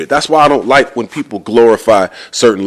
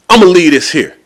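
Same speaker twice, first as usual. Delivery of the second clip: acted defiant huff; 7 semitones higher, 4 dB louder than usual. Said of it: "he throws the fax machine down like".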